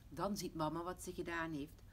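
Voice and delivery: ordinary delivery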